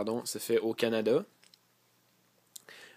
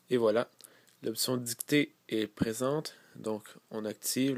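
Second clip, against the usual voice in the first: ordinary talk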